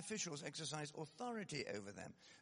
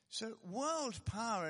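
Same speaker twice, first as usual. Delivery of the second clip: louder and slow, leaning on world; emphatic announcement; 9 semitones higher, 8 dB louder, much slower than usual. Real, not acted. Preacher opening his point on world power, loud and slow. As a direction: announce the new point with weight.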